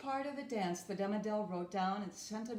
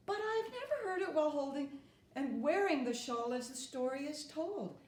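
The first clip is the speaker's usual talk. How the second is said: ordinary delivery, nothing marked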